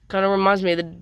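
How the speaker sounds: usual speaking voice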